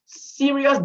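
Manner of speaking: normal talking voice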